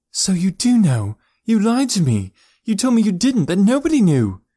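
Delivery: high, loud furious accusation; angry outburst. A narrator's reading. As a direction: shout it at him, betrayed. Elora confronts her lying uncle.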